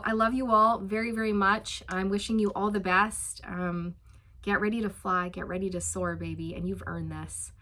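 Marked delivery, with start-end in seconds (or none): none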